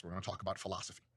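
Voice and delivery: usual speaking voice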